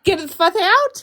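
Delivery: high, slow disbelieving repeat; repeating his demand back, stunned and incredulous; incredulous echo. Real, not acted. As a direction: throw the words back in disbelief.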